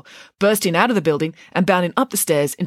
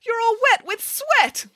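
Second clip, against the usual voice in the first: very high, drawn-out squeal of revulsion; princess's high, horrified complaint; disgusted scream; slower than usual. A narrator's reading. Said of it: "she screamed less formally".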